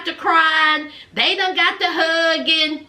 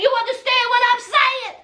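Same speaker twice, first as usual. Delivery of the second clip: sassy challenge; 9 semitones higher, faster than usual. Retold or imitated. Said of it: "And she said".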